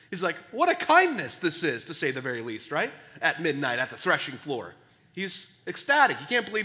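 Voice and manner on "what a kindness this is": high, loud burst of wonder; amazed joy. Retold or imitated. Boaz marvelling at Ruth's kindness, excited exclaim. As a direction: exclaim it as if stunned. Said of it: "He can't believe it".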